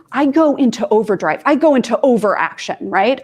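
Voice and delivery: normal talking voice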